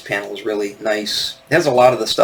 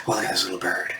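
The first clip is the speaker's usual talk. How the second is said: low, hushed aside; Bob Ross-like softness; 4 semitones lower, 9 dB quieter, faster than usual. Real, not acted. Host remarking on a little bird outside, soft and low. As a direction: speak softly and low, like a gentle painting host. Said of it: "I sound like Bob Ross now".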